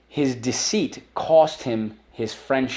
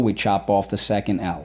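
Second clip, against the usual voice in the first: normal talking voice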